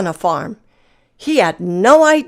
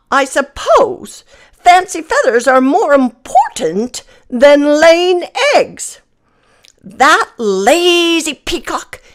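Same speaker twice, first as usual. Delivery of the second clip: grumbling hen; 5 semitones higher than usual. Acted. Hen grumbles to the others about the lazy peacock. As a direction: complain like an indignant hen.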